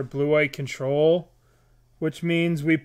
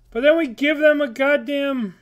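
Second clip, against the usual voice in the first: exasperated outburst; 10 semitones higher, 5 dB louder than usual. Real, not acted.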